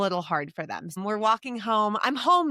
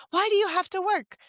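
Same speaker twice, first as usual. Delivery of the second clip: put-on high child voice asking why; imitating her son's question; young son; 12 semitones higher than usual. Mom voicing her young son.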